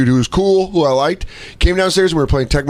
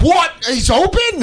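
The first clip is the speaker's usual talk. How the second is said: squeaky high what, he's open; mock surprised opponent; slower than usual. Opponent realising his receiver is open, very high mock surprise. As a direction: pitch it way up in mock shock. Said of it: "he'd be like".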